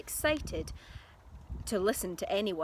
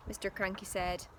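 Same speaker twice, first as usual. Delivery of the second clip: usual speaking voice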